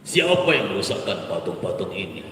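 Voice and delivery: deep stern royal demand; angry king. Shown as a demonstration. King Namrud questions his soldier, deep and stern. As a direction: press the question like a tyrant. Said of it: "king repeats the question".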